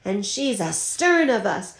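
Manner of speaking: high pitched triumphant call that settles at the end; captain calls out to the crew; roaring excitement. Acted. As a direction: shout the news across the deck. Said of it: "he roared".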